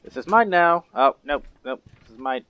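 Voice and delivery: high, loud gloating; loud, high gloat at a stolen prize; silly clown. Pretend. Goose stealing item, gloating.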